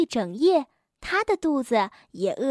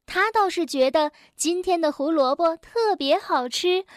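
usual speaking voice

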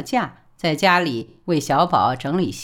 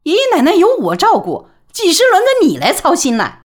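much higher and louder, indignant; sharp retort; 12 semitones higher, 9 dB louder than usual. A narrator's reading. Mother retorting to her son, high indignant snap. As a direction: snap back at him.

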